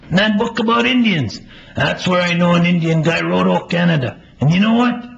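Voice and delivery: high, loud, eager voice. Acted. Playwright voices the eager friend reassuring Melvin, high and loud. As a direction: answer like it's obvious, bursting with certainty.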